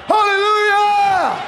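high, loud, slow hallelujah; preaching shout. Real, not acted. Preacher shouting hallelujah to the congregation. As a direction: lift the church with it. Preacher exclaims mid-sermon.

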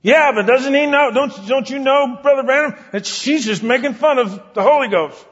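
high, loud protesting whine; high and loud indignant plea; frustrated objection. Retold or imitated. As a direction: complain to the preacher, exasperated. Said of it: "pleading man objects".

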